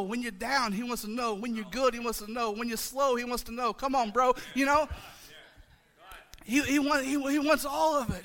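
loud, rising preacher's build; preaching. Acted. Pastor rallying the church, loud preaching. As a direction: preach it full force to the congregation.

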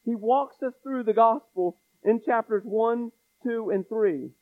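ordinary talk, nothing marked

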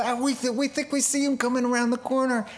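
high quick happy chatter of a daughter; excited daughter. Retold or imitated. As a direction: squeal it like a girl spotting her dad. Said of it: "She says".

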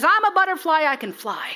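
squeaky high boast as the worm; proud little worm. Retold or imitated. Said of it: "the worm that says".

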